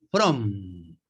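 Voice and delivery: From, lips pressed shut on the final M; closed mouth on M. Shown as a demonstration. Teacher showing students the closed-lip M, exaggerated. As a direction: end From with the mouth firmly closed. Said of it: "boca cerrada, porque es M".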